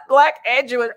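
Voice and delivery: high crying shout of mock disbelief; shocked outcry. Repeating the news to viewers, mock-shocked cry. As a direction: cry it out, pretending to be floored.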